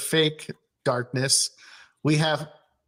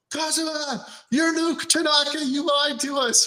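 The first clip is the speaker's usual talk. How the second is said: mock yelling; 11 semitones higher than usual. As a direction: imitate an angry accusing yell, pitched way up. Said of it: "starts yelling".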